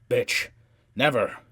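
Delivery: loud, explosive angry shout; furious refusal. A narrator's reading. Man sweeping her off the bench, shouting furiously. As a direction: hurl each word out hard.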